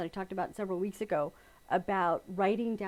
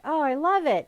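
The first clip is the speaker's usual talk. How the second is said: higher, louder gush of love; mock delight; 5 semitones higher, 8 dB louder than usual. Quilter imagining early love of a project, acted delight. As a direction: gush over it like a new favorite.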